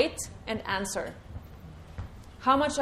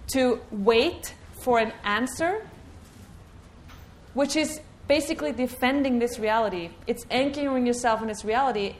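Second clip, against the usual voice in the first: ordinary talk, nothing marked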